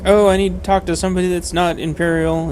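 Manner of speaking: high, loud mock American voice; imitating American accent. Streamer imitating the spy character's American voice, high and loud. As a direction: quote the character playing it American. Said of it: "He talks like an American".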